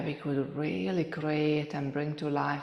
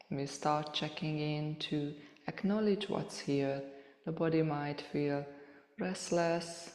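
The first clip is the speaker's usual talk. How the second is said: soft, unhurried meditation voice with long pauses; softly spoken, spacious yoga relaxation cueing; guided meditation; 5 dB quieter than usual. Real, not acted. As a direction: gently guide listeners through a body scan, leaving space between phrases.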